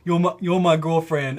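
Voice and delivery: mock the man's slurred, clumsy claim; mimicked pestering customer. Imitating a customer pestering the shop girls, put-on voice. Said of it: "walk up to all the girls ... and be like".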